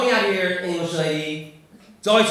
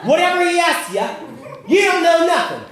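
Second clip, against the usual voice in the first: high, loud put-on English ringleader; hammed-up English plotter giving orders; conspiring English audience; 8 semitones higher, 5 dB louder than usual. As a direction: coach the gang in a pitched-up mock accent. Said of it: "Get together out on the street before the show?".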